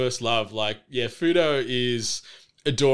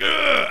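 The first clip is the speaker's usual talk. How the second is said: high, loud effortful grunt; straining grunt; 5 dB louder than usual. Retold or imitated. Fighters holding each other back, mock straining grunt. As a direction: grunt as if locked in a shoving match. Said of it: "holding each other back".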